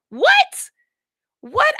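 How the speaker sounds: very high, loud disbelieving squeal; thrilled squeal. Acted. Accent lover reacting to French, high excited squeal. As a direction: squeak it out, overcome with delight.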